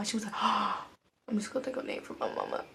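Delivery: usual speaking voice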